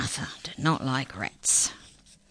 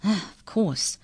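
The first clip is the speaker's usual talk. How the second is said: sudden realisation, pitched higher; cried; 5 semitones higher, slower than usual. A narrator's reading. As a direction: cry out as it dawns on you. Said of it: "cried the first incisor".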